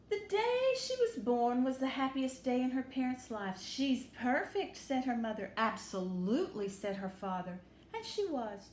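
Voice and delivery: lively storybook read-aloud; bright, warm picture-book reading; reading to children. Acted. Teacher reading Chrysanthemum aloud to children.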